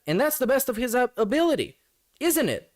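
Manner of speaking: sarcastic jab in a high voice; mock sarcastic. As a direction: sneer it up high like a taunt.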